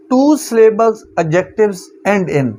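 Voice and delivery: very slow, word by word rule reading; slow dictation. Shown as a demonstration. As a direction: read the rule out slowly for notes. Reads rule title aloud. (धीरे-धीरे नियम पढ़ना)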